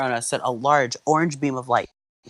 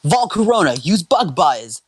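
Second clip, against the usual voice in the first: spirited, higher-pitched battle order; 6 semitones higher, 4 dB louder than usual. Acted. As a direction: call the move to your Pokémon. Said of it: "Alder, Alder ordered".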